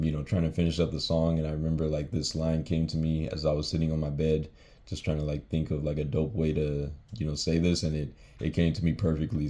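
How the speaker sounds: usual speaking voice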